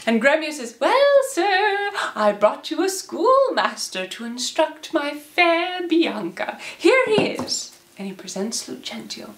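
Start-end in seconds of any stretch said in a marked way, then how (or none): 0.8-7.8 put-on high, swooping old man voice; proud old suitor. Gremio offering Baptista a schoolmaster, proud old-man voice. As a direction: present your find with showy pride.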